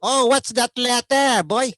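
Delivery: imitating Filipino dad asking about the letter; Filipino father. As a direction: ask in a high Filipino accent. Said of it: "but my dad is at the table".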